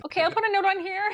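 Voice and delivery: high, hurried agent voice; imitated phone agent. Agent agrees to note the time, high hurried imitation. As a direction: mimic the agent, high and quick. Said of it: "this is the conversation I had".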